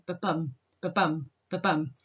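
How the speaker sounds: ba-bum beats, weak BA then stronger BUM; low, evenly spaced heartbeat pulses on "ba-bum"; heartbeat rhythm. Shown as a demonstration. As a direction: tap out "ba-bum" like a heartbeat, pausing between beats. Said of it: "like the rhythm of the heartbeat".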